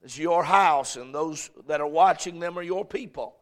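fervent pastor's prayer. Acted. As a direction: pray over the congregation. Leads congregational prayer.

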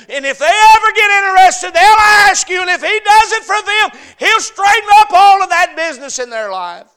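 high, loud climactic sermon cry; fiery revival preacher at the top of his voice; full-force preaching. Acted. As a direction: build it to a shouting peak.

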